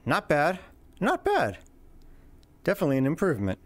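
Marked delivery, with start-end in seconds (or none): 1.0-1.6 high squeaky playful take. Pretend.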